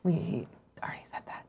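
hushed whispered aside; whispering. Real, not acted. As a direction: whisper it off mic.

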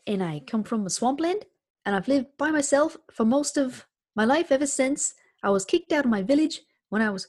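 an upbeat little gnome keeping his spirits up; cheerful gnome voice. Pretend. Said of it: "give us a teeny little teaser of said voice".

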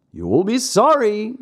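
cat echoing. A narrator's reading. The cat parroting the fox's warning to Pinocchio, high and odd. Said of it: "repeated the cat".

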